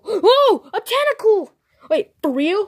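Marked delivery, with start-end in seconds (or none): 0.0-1.5 high, loud gasp of discovery; excited high discovery; surprised. Pretend. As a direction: shout it like you just spotted treasure. Kids discover a wild Pokemon.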